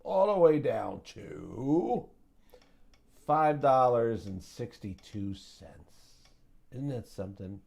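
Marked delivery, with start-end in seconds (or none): 0.0-6.2 slow, louder, stretched drop; drawn out. Real, not acted. Trader stressing the stock's crash, slow and drawn out. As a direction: draw it out all the way down.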